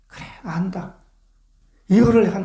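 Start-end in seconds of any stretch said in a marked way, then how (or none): 0.1-1.0 soft knowing whisper. Acted. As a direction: breathe it as quiet reassurance. God answering in a whisper. (다 안다는 듯 속삭이며)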